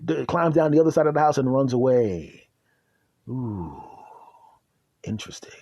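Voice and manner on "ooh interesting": very soft, low, near-whisper; hushed aside. Acted. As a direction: murmur it as a quiet aside. Dry aside after chase story.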